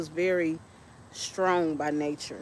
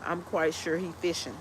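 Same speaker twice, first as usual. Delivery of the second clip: ordinary talk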